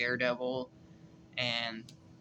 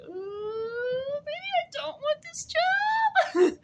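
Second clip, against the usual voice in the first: much higher, slow, mock-frightened squeal; scared squeal; 4 dB louder, much slower than usual. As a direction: pretend you're terrified of the boss. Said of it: "I'd be like".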